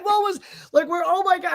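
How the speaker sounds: high-pitched, startled exclamations; acted shock. Retelling the office reaction to the blast, startled. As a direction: blurt it out high, reliving the shock.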